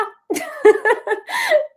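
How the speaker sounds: high, loud burst of laughter; real high-pitched giggling fit. Real, not acted. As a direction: laugh out loud at your own boast. Laughing at her own boast.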